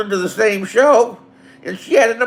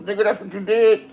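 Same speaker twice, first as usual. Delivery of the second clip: ordinary talk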